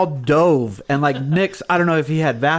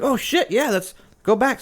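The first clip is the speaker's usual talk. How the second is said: retold excitement; 9 semitones higher than usual. Telling how he jumped at the set list idea, high-pitched mock excitement. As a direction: re-enact a sudden eager yes. Retells his excited reply to friend.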